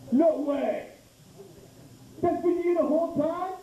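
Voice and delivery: high-pitched mock disbelief; astonished tour guide. Tour guide discovering who made cricket noises, high astonished exclamation. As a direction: pitch leaps up in amazed realization.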